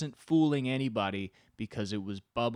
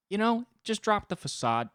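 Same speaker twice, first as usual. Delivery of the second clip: exasperated outburst; 13 semitones higher, 8 dB louder than usual. Pretend. Pleading with server, bursting out.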